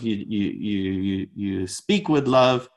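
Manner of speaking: ordinary delivery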